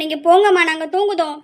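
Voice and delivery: normal talking voice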